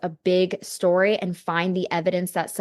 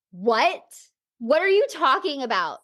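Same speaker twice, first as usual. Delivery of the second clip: confused surprise; 7 semitones higher than usual. Retold or imitated. Her confused reply in retold talk.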